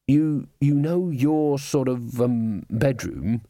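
slow, hesitant and halting. A narrator's reading. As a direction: stumble over it slowly. Hesitant character.

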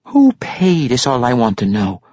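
ordinary delivery, nothing marked

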